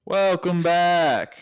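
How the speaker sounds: drawn-out, high playful holler; mock-bellow the greeting, stretching it out; hammed-up slow shouted welcome; exaggeratedly loud. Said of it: "That was so loud".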